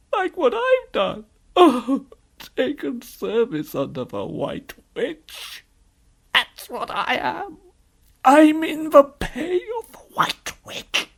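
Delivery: slow, high, loud faun wail; wailing confession. Acted. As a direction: drag each phrase out in anguish. Faun wails out his confession.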